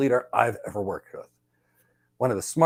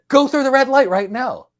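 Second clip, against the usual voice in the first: loud, high-pitched urgent command; urgent order; 12 semitones higher, 8 dB louder than usual. Retold or imitated. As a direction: order your partner to move immediately. Urgent order in retold emergency.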